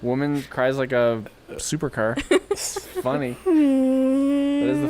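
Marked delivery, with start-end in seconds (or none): 2.5-4.9 mock wailing. Imitating woman crying like car.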